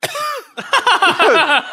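high strained laugh; pained laugh. Real, not acted. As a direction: laugh high through the burn. Laughing through spicy pain.

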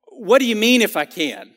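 mildly irritated Jesus asking. Shown as a demonstration. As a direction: snap the question like he's insulted. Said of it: "Like he's a little bit irritated".

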